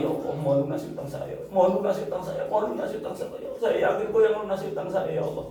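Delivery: repeated plea rising high and thinning out; retold tearful begging. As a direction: imitate begging God through tears, over and over. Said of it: "Sampai nangis-nangis itu". (menirukan permohonan berulang sambil menangis)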